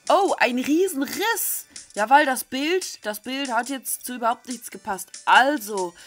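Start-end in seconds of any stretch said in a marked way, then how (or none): 0.1-1.6 higher, slow exclamation; sudden realization. Real, not acted. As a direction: stretch it out as it dawns on you. Suddenly understands the word. (überraschte Erkenntnis)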